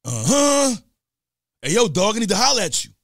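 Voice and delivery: loud raspy-free high call, imitating [name] greeting. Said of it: "So X goes".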